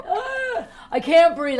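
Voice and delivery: high, loud panicked gasp; mock panic. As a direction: play the panic up, gasping. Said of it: "I go right into panic mode".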